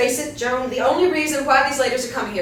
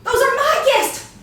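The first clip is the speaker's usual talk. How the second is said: high, laughing outrage; shrill disbelief as her friend walks off; indignant squawk; 6 dB louder than usual. Acted. Wendy left behind, indignant.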